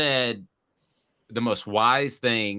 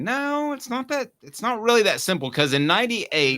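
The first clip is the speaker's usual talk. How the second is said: much higher, insisting hard; emphatic protest; 12 semitones higher than usual. Real, not acted. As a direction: push back, voice jumping up. Objecting to a remark about fighting.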